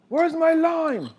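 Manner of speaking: high, loud, slow mock chef bellow; Gordon Ramsay impression. Dad hamming up an impatient TV chef demanding his lime. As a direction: play a ranting celebrity chef for laughs. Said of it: "Chef Gordon Ramsay mode".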